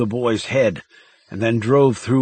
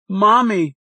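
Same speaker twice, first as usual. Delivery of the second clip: put-on scared child; 9 semitones higher, 4 dB louder than usual. A narrator's reading. Scared boy calls mother.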